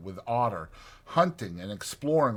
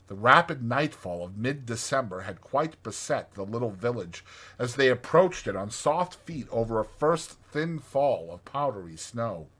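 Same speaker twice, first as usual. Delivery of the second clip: ordinary delivery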